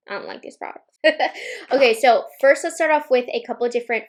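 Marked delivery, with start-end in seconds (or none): 0.1-1.0 secretive whispered confession. Shown as a demonstration. Whispered repeat of opinion. 1.0-1.7 breathy giggle. Real, not acted. Laugh after whisper.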